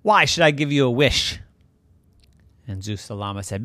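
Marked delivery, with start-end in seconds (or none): 0.0-1.4 loud, indignant god; big loud voice. Acted. Zeus asked for a wish, big loud voice. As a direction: boom back the question, pitched up. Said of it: "the big, loud voice said".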